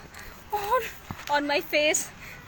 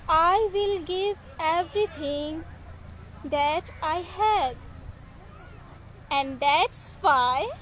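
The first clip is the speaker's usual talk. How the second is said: poetry recitation; 4 dB quieter than usual. Acted. Poet reads own English poem to live audience, measured recitation. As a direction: recite it line by line, letting each phrase land.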